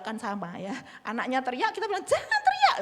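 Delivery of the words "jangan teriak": put-on much higher and louder, yelling at a child; shouting at child. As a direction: yell "don't shout" back at the kid. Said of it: "padahal kita juga teriak ya".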